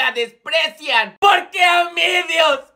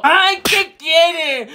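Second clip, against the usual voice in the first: slow, louder annoyed groan; groggy annoyance; 3 semitones higher, 4 dB louder, much slower than usual. Acted. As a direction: drag it out, irritated at being woken. Emilio despertado de golpe.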